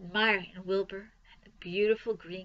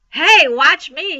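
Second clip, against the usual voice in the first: loud, drawn-out, higher yell; excited yell; 7 semitones higher, 15 dB louder, slower than usual. A narrator's reading. Boy showing off to the family, yelling. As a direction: holler for everyone's attention. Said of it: "yelled Avery".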